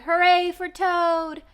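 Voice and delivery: high, loud and slow cheer; cheering shout. Acted. Frog shouting hooray to Toad in the dream. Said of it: "as he shouted".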